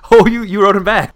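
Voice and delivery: loud, high gasp of discovery; delighted shock. Acted. Friend discovers she wrote him back, delighted shock. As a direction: pounce on the juicy find.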